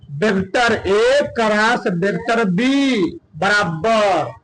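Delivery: very slow, spaced-out symbols; slow classroom read-out; formula dictation. Real, not acted.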